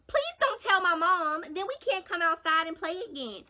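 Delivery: loud, high mock plea; pleading friend. Friend begging her not to tell mom, mock pleading. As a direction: whine it loudly as the begging friend. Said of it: "you know what Alexis said to me".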